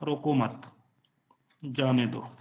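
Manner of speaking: quiet रोको मत, pause, quiet जाने दो; pause after मत. Shown as a demonstration. Teacher repeating the changed sentence to students, soft pause after मत. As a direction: softly join रोको मत, then break before जाने दो. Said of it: "प्लेसमेंट गलत कर दी है कॉमा की".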